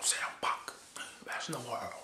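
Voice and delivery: soft unvoiced whisper into his ear; whispered flattery. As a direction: breathe it like a secret. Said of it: "Paul Heyman saying".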